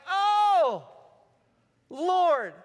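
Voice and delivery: loud, high cry of Oh, Lord; sudden realization. Retold or imitated. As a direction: burst out with a sudden revelation. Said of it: "suddenly I'm like".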